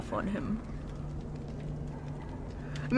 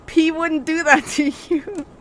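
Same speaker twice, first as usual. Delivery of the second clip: laughing; 6 semitones higher, 5 dB louder than usual. Real, not acted. Laughs through the line.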